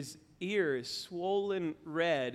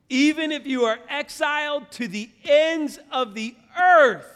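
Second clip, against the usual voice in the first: impassioned preaching; 10 semitones higher, 12 dB louder than usual. Acted. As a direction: proclaim the verse at full force. Verse repeated for emphasis.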